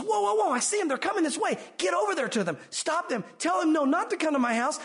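high-pitched, urgent acted plea; alarmed orders. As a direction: send the servants to head them off. Acting out centurion's alarmed orders to servants.

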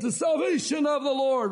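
big mock-heroic proclamation; proclaiming Moses. Moses on a windy shore, hammed-up proclamation.

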